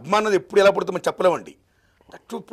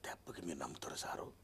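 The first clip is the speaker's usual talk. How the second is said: much softer, breathless whispered awe; awed whisper; 12 semitones lower, 18 dB quieter than usual. Retold or imitated. Telling the host how NTR stunned him, a soft awed whisper. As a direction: hush it like you just saw a miracle. Retelling awe at NTR's screen.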